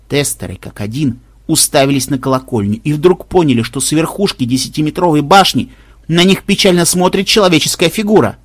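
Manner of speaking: usual speaking voice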